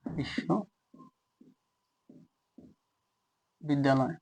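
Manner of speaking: very slow, soft বিশ্ববিদ্যালয়, broken into parts with long pauses; লিখতে লিখতে উচ্চারণ. Shown as a demonstration. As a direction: say বিশ্ববিদ্যালয় piece by piece, as if writing it out. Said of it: "এই জায়গায় লিখি যে". (বিশ্ববিদ্যালয় খুব ধীরে, থেমে থেমে, নিচু গলায়)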